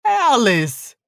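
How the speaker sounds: distressed, drawn-out appeal; crying out. A narrator's reading. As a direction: call to her in dread. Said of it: "I cried".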